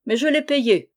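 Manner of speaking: high, loud indignant cry. A narrator's reading. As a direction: protest it loudly. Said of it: "cria Jean-Marie". (cri indigné, plus fort et plus aigu)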